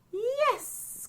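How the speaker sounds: a high, eager yes; excited cry. A narrator's reading. Witch gladly welcoming the bird, high cry. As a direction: squeal it gladly. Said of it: "cried the witch".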